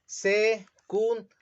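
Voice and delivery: "Secundaria" split slowly as se-cun-da-ria; "Secundaria" broken slowly into its syllables, se-cun-da-ria; slow syllabified. Shown as a demonstration. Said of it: "¿Cómo queda?".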